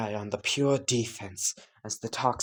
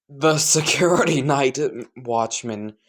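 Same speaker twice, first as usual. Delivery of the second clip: usual speaking voice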